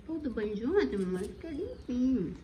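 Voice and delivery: high squeaky voice for the dog. Real, not acted. Baby talk to dog.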